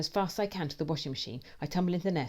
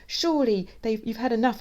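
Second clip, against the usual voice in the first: high, loud, urgent voice; frantic plea; 8 semitones higher, 6 dB louder than usual. A narrator's reading. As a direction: urge it frantically. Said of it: "I whisper, frantically".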